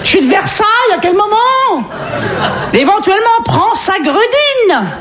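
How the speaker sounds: hammed-up outrage, a high posh lady; outraged posh lady. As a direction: play a high-pitched, scandalised Versailles snob. Outraged posh Versailles lady reply.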